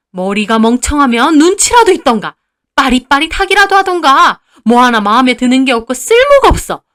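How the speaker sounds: loud, high, contemptuous tirade; scolding mother-in-law. A narrator's reading. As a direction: berate a useless underling without letup. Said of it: "저를 막 나무라면서". (며느리를 심하게 나무라는 말투)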